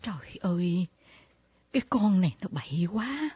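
hushed whispered disapproval; whispered aside. Acted. Mother whispering her disapproval of Diễm. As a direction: whisper it to yourself.

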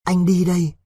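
a touch of pained tenderness, understated; moved and pained. A narrator's reading. Đối telling Vịnh he is leaving, mildly pained. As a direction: say it as a mild, hurting farewell. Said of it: "nói với nó bằng cái giọng rất cảm động, đau đớn".

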